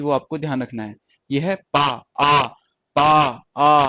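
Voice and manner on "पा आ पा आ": पा and आ with the vowel held long. Shown as a demonstration. Teacher demonstrating long vowels, drawn-out आ. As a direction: stretch the open आ in each. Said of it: "ये long है".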